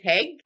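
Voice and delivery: "heg" with an added h before egg; h-dropping demo. Shown as a demonstration. Showing how he says egg as "heg". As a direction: say "heg", putting an h sound in front of egg. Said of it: "you say".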